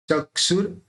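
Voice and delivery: ordinary talk, nothing marked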